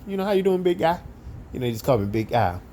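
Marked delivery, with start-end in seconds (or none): 0.1-1.0 bright high-pitched greeting. Retold or imitated. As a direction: call out the greeting up high and friendly. Coworker's greeting voiced high.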